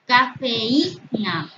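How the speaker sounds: "Cafeína" very slow, syllables separated; drawn-out example word. Shown as a demonstration. Teacher showing the hiatus in a word, drawn out. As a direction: stretch "Cafeína" so the í stands apart.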